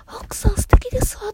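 put-on high, loud swooning young lady; dreamy princess voice. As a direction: gush like a love-struck princess. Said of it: "とか言うのよ".

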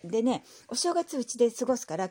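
usual speaking voice

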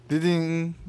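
loud high "ででん" jingle; quiz jingle sound. Pretend. Quiz show sound effect voice. (クイズ番組の効果音風に)

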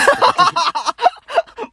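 high shrieking burst of laughter; a shocked squealing laugh. Real, not acted. Shocked by the confession.

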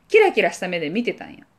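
ordinary talk, nothing marked

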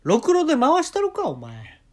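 hoog en luid nagespeeld dreigement; dreigende tirade. Retold or imitated. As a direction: dreig hamerend als een doorgedraaide vader. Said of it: "普通に怖いな".

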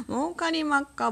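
high-pitched gag in Kansai accent; mock Kansai merchant accent; joking Kansai dialect. Shown as a demonstration. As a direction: toss out a comic Kansai greeting. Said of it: "言ったとしてもギャグよね".

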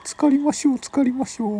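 high-pitched squealing excitement; host squealing with glee as they get in the bath; giddy squeal. Acted. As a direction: squeal like an overexcited fan.

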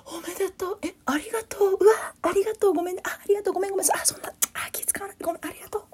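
high squeaky pretend voices; bashful little party voices; playacted party guests. Playacting a surprise birthday party.

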